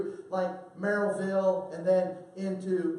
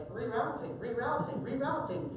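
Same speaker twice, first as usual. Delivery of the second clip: imitating the GPS; 3 semitones higher, 6 dB quieter than usual. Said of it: "she used to say".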